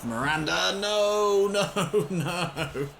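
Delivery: watching his keeper blunder, laughing in horror; laughing despair. Real, not acted. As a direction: cry out in disbelief. Keeper error, conceding.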